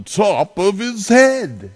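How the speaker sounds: high, loud comic lift; playful flourish. A narrator's reading. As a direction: pop the words up with glee.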